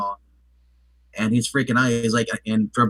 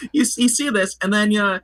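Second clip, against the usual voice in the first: laughing; 7 semitones higher than usual. Real, not acted. Laughing while retelling.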